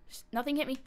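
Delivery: ordinary talk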